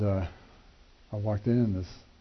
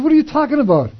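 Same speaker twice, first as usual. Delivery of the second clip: much higher, louder stunned "Who? What?"; stunned disbelief; 10 dB louder than usual. Retold or imitated. Hearing he's recommended for officer school, shocked outburst. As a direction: blurt it out, floored by the news.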